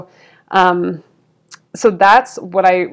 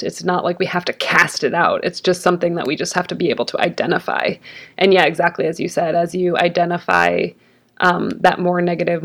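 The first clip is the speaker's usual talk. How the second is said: usual speaking voice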